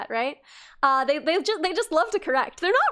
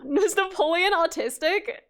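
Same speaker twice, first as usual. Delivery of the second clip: ordinary delivery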